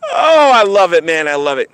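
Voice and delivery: ordinary delivery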